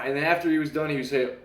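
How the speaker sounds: usual speaking voice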